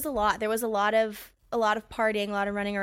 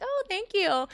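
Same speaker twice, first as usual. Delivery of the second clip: sweet high thanks; 9 semitones higher, slower than usual. Retold or imitated. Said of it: "and I'm like".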